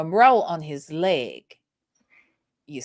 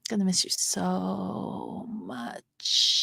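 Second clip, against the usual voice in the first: whispered goodbye. Pretend. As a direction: whisper it like a farewell.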